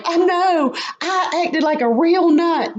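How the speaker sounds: high, giddy mock admission; re-enacted gleeful self-mockery; amused confession. Laughingly admitting it to God.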